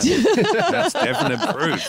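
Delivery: slow, high laughing sarcasm; sarcastic laughing. Real, not acted. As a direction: laugh it out mockingly. Sarcastic reply to ghost story.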